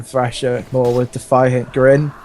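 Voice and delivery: ordinary talk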